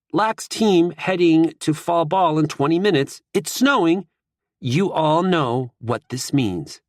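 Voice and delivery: captain's message read slow and high; captain's announcement. Acted. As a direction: announce it like a call to arms. Group chat message from team captain.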